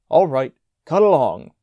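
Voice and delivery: loud brisk dismissal; brisk command. A narrator's reading. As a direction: send them off sharply. Prefect dismissing boys.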